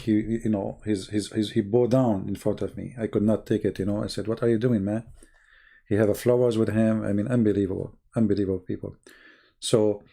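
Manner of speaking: usual speaking voice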